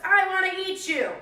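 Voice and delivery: high, loud hungry horse. Acted. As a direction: threaten like a big horse. Horse speaks.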